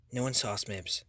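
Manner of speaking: soft whisper back. Acted. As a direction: keep it low and secret. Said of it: "he whispered back".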